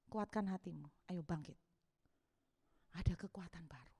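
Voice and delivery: low, soft fatherly voice; God speaking. Acted. God to his worn-out child, soft and low. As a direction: speak low and hushed, with quiet authority. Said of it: "tiba-tiba Tuhan bicara".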